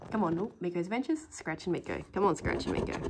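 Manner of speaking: ordinary talk, nothing marked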